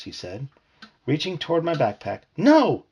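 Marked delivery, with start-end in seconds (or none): 2.4-2.8 sudden high, loud "No!"; sharp shout. Acted. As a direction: snap out a panicked no. Rod stops Mickey grabbing backpack.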